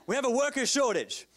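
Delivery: high, loud proclamation; preaching shout. Real, not acted. Preacher corrects his polite wording, preaching shout. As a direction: belt out the blunt truth.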